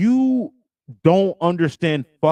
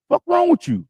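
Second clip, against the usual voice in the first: angry outburst; 14 semitones higher than usual. Real, not acted. Host fed up with guest's provider talk, exasperated high outburst. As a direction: pitch jumps way up in exasperation.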